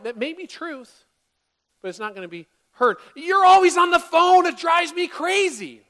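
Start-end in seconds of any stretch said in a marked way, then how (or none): 3.1-5.8 much louder, much higher furious yell; full furious yell; yelling demo. Shown as a demonstration. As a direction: blow up at them over the phone. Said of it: "That's yelling".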